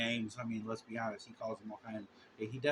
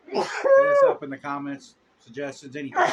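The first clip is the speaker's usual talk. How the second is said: calling out; 10 semitones higher, 9 dB louder than usual. Real, not acted. Calling out to viewers.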